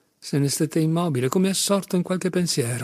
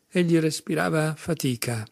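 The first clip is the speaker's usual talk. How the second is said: plain reading voice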